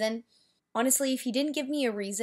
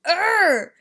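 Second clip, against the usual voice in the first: loud exasperated urgh; frustrated groan; 8 semitones higher, 9 dB louder than usual. Real, not acted. Venting to camera about the car, a loud fed-up urgh. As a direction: let out a fed-up growl of annoyance.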